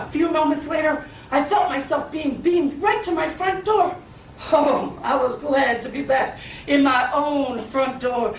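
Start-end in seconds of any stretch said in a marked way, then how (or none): none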